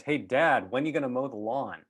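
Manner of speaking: calling out. Retold or imitated. Teenage son calling to his dad in the laundry room, casual. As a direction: toss the question across the house.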